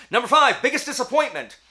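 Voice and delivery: big, loud countdown call; loud announcement. Real, not acted. Vlogger announcing the next category, loud and high. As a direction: boom out the category like a show host.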